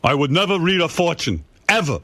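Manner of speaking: stubborn dad swearing off fortunes; imitating father. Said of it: "father's rule".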